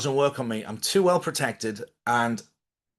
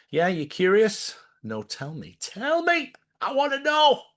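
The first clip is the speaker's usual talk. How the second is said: pleading viewer, much higher; curious viewer; 10 semitones higher than usual. Pretend. Imagined viewer begs to hear the story, high eager voice. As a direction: squeal like an eager fan begging to know.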